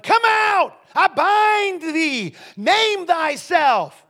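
loud, high, slow mock exorcism command; a booming commanding cast-out, drawn out; exorcist shout. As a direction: bellow it like a showy exorcist.

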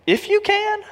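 astonished echo, pitch leaping up; incredulous question. Acted. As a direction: throw the words back in disbelief. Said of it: "verse twenty-three".

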